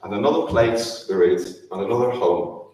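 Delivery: normal talking voice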